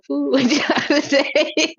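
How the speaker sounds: singsong collapsing into laughter; cracking up. Real, not acted. Refusing to fool with y'all, cracking up. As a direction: start steady, then climb into helpless laughing. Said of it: "I'm cracking up 'cause I really do be tickled".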